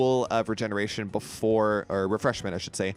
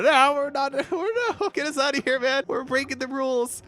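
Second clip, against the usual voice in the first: alarmed protest; 13 semitones higher than usual. Real, not acted. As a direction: yelp in alarm as the portal throws them off. Teleported the wrong way, alarmed.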